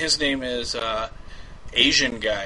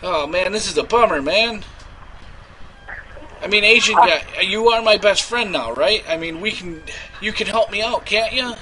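whiny pleading; 5 semitones higher than usual. Acted. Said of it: "I will play me".

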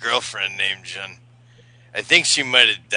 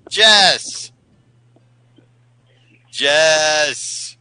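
drawn-out call of a name, pitched up; raised, lingering call; 4 semitones higher, 3 dB louder than usual. Pretend. Calling out to the caller by name.

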